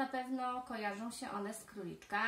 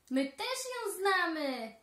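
high, loud, slow called-out line; cheerful squirrel voices; calling squirrels; 7 semitones higher, 7 dB louder than usual. Acted. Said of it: "wołają wiewiórki".

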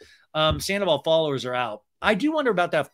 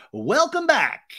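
high, loud show opener; mock host welcome; 10 semitones higher, 5 dB louder, much slower than usual. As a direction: announce it brightly to camera. Acted host's on-air greeting.

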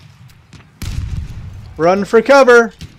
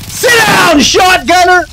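taunting shout; 9 semitones higher than usual. Real, not acted. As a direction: yell it down at the enemy. Taunting an enemy.

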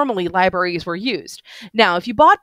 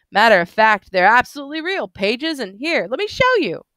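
put-on higher, eager tipsy voice of an old bookish drunk; excited drunk man; 4 semitones higher than usual. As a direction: gush about the books like a tipsy fan. Drunk man shows the books.

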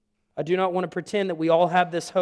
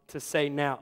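ordinary delivery

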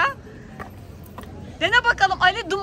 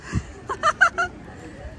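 airy laugh; 10 semitones higher, 4 dB quieter than usual. Real, not acted. Laughing at smoke.

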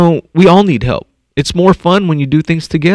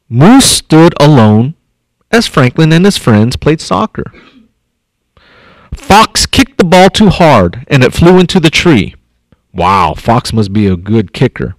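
ordinary talk, nothing marked

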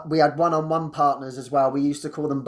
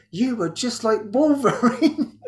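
laughing quote; 8 semitones higher, slower than usual. Retold or imitated. Laverne's praise retold with laughter.

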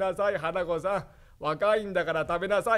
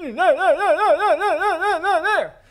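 toothless ancient grandpa; 10 semitones higher, 5 dB louder than usual. Acted.

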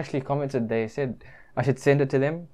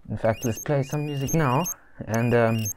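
muffled behind cloth mask; speaking under covered mouth; through a face mask; 2 semitones higher, 4 dB louder than usual. Real, not acted. Seen 5: LED mask covers mouth and nose throughout; reference frames show no mask.

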